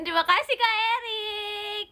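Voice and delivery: sudden high shout with a long-held name; berubah jadi teriak. Shown as a demonstration. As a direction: burst into a shrieked thank-you. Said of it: "berubah jadi kayak gini". (mendadak teriak nyaring dan panjang)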